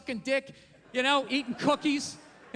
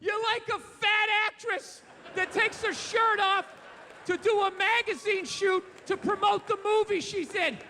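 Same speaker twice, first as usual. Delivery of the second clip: sarcastic starstruck praise; mock gushing awe; 8 semitones higher than usual. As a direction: gush over it like it's heroic. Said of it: "Am I gonna sit there like".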